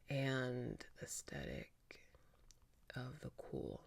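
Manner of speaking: soft whisper, drawn out. Real, not acted. Recalling a college book title, in a slow whisper. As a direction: breathe the title to yourself, slowly.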